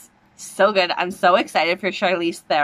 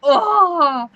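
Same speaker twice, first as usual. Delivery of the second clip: higher, louder burst of delight; excited cry; 9 semitones higher, 5 dB louder than usual. Real, not acted. Reacting live to trailer.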